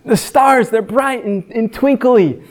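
put-on a small kid telling his dad; child's voice. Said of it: "he's like".

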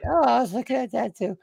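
very high mock wife impression; wife's voice. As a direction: put on the wife's high little voice. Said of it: "why is that the voice that I went with to talk about my wife".

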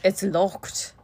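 put-on British accent; Harry, British accent. Imitating Harry at the locked door, British accent. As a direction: repeat it with British rounded vowels. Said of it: "Harry was kind of British there".

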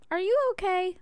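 high, concerned voicing of the guys asking. Guys on the porch ask if she's okay, high and concerned.

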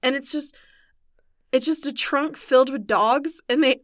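ordinary talk

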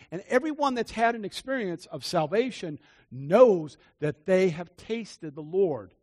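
ordinary talk, nothing marked

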